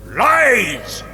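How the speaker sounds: loud, high exclamation to a crowd; outraged cry. Acted. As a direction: hurl the word out as a denunciation. Orator denounces the culling myth.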